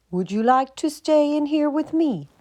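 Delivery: a proud squirrel inviting the geese in. Acted. Squirrel offering its hollow tree, high and proud.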